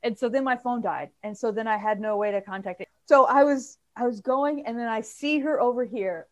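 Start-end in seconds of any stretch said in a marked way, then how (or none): none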